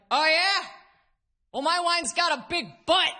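put-on drunk guy. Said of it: "He's like".

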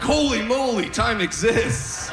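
very high, drawn-out astonished cry; mock amazement. Comic looking at his new watch, hammed-up amazement.

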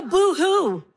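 mock sympathy. Mother mocks children's complaint.

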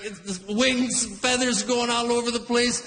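ordinary talk, nothing marked

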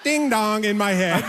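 ordinary talk

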